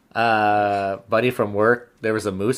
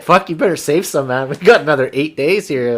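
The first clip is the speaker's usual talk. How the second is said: mock scolding; 5 semitones higher, 7 dB louder than usual. Buddy scolding about bug spray.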